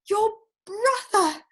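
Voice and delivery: high, drawn-out reveal; excited announcement. Acted. Niece reveals a surprise visitor to Uncle Jack, excited announcement.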